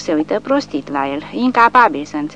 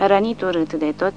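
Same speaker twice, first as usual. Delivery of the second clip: ordinary delivery, nothing marked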